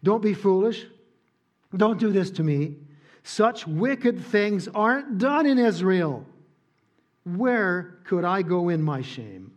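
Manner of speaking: pleading Tamar. Acted. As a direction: beg her brother to stop. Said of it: "confronts him with the truth and says".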